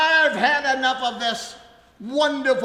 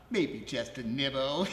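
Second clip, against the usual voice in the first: sinister low murmur; 10 semitones lower, 7 dB quieter than usual. Acted. Suitor hinting to Hilda he might bite, darkly. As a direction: drop low and soft with menace. Villain hints he bites.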